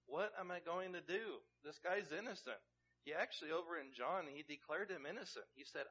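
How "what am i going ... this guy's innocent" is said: pleading Pilate. Retold or imitated. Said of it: "I think Pilate really is asking".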